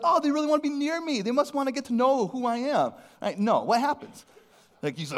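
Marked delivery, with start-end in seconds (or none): 0.0-2.9 sarcastic. Retold or imitated. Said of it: "they're like".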